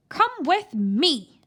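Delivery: loud, slow granny command; demanding. A narrator's reading. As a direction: order him along firmly. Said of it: "she demanded".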